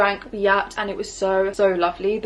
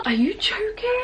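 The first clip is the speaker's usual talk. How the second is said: high squeak of disbelief; delighted high are you joking; amazed; 14 semitones higher, slower than usual. Real, not acted. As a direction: shoot way up in pitch, can't believe it. Overwhelmed by the gift set.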